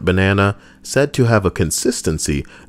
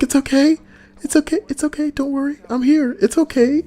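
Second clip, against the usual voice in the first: much higher, tender mock comforting voice; soothing boyfriend. Boyfriend comforting his crying girlfriend, mock tender high voice. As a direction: coo at her like a doting boyfriend. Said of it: "and he's like".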